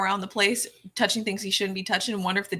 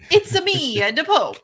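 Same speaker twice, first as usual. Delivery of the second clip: high, loud Mario-style "It's a me"; 8 semitones higher, 7 dB louder, slower than usual. Pretend. Joking to his co-host as Mario the Pope, high and loud. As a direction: bounce it like the video game plumber. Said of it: "Mario voice joke".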